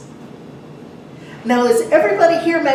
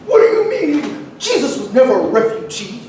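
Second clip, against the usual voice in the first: lampoon the scandalized critics, laughing; hammed-up huffy disbelief; mocking indignant quote; 4 dB louder than usual. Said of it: "them saying".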